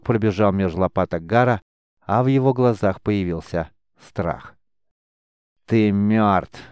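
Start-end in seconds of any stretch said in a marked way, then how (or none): 5.7-6.6 understated malicious verdict; angry, haughty. A narrator's reading. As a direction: state it with cool arrogance. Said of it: "зло и надменно констатировал Десятник". (зло и надменно)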